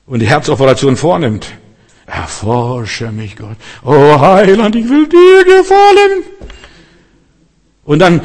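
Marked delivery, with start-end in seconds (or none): 2.0-3.6 very slow, low and soft, mimicking a sufferer; subdued, strained prayer. Believer prays while God operates on the heart, weakly and haltingly. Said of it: "Aber wenn es darauf ankommt nachher". 3.8-6.2 rising to a high, loud, held wail; mock anguished prayer. Believer under God's testing cries to the Saviour, wailing theatrically.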